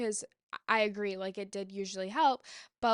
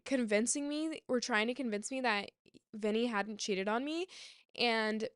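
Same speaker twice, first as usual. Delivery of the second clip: normal talking voice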